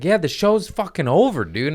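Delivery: high-pitched exasperation; retold angry yell. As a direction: re-enact telling a friend off. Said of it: "I got mad at him. I yelled at him".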